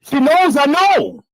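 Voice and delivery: high, loud, slow gloating; taunting boast. Acted. Taunting the rival YouTuber, loud gloating.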